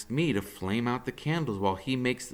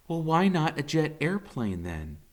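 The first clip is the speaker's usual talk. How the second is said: mother's voice; 8 semitones higher than usual. A narrator's reading. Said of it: "the Fair Gwen says".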